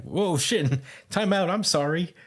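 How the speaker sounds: mock-alarmed appeal to stop; alarmed plea. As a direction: hurriedly call a truce, lightly acted. Confused character pleads with his attacker.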